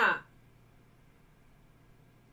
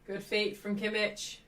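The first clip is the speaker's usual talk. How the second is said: ordinary talk, nothing marked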